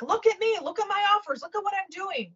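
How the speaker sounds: high-pitched mock attention-seeker; going big for attention. Coach mocking projectors pushing their offers, high and showy. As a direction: imitate someone clamouring to be noticed, pitched high. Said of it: "out there going big and like".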